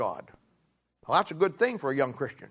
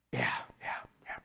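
whispered, embarrassed yeah yeah; ashamed whispered confession; sheepish whisper; 12 dB quieter than usual. Retold or imitated. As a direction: breathe it out like a caught man admitting it. Sheepish admission to the pastor.

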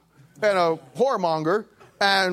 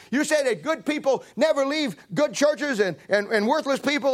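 excited lady; 7 semitones higher than usual. Retold or imitated. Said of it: "She says".

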